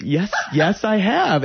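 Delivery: high, loud, startled yes with a laugh; lekko zaskoczony. Retold or imitated. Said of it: "a little surprised".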